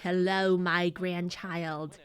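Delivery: slow, low grand-lady greeting; matriarch grandmother. Acted. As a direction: greet like a stately family matriarch. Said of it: "grandma's like".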